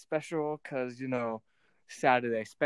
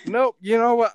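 defiant loud nope; 9 semitones higher, 9 dB louder than usual. Real, not acted. Defiant refusal to wrap up.

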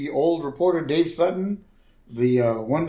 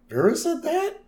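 1 mock surprise. [name]'s surprised question, high.

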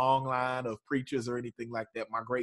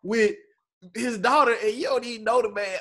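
mock disbelief; 9 semitones higher than usual. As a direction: drag it out, high, in disbelief. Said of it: "I'm like".

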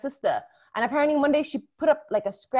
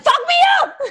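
much higher and louder, laughing yell; outraged yell; 10 dB louder than usual. Retold or imitated. Retelling shouting back at her sister, laughing yell. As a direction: bellow it back in disbelief. Retold fight with sister, yelling.

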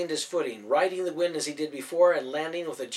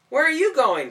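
sharp, raised, higher question; startled shout up the stairs; alarmed call; 12 semitones higher, 9 dB louder than usual. Acted. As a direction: call out after them in alarm. Jude calls after leaving friends.

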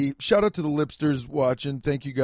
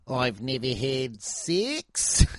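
slow, hammed-up Australian accent; much slower than usual. Mimicking a Love Island Australia contestant, in a slow Australian accent. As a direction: stretch it out in a mock Aussie accent. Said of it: "the only thing I can say in an Australian accent".

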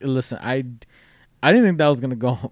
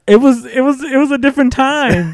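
high-pitched, louder pleading excuse; mock defensive protest; 11 semitones higher, 5 dB louder than usual. Friend teased about being fifteen, protesting defensively. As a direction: squeal it in comic self-defence.

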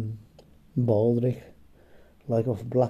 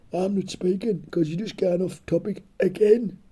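high huffy complaint; sulky woman voice; 7 semitones higher than usual. Pretend. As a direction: sulk in a higher voice. Imagined listener complaining.

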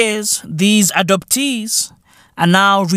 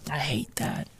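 breathless whisper of disgust; 5 semitones lower, 16 dB quieter than usual. Shown as a demonstration. Ranting vlogger whispers his disgust at the name. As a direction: hiss it under your breath.

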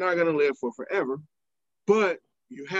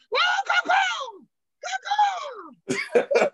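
excited yelling. Retold or imitated. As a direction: whoop them on while running past. Running through concourse yelling at fans.

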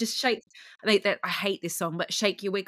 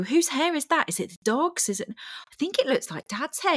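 lightly voiced curious children, pitched up; puzzled kids; 8 semitones higher than usual. Host imitating kids finding hair on the elf, puzzled. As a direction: wonder aloud in a higher, imitated kid voice.

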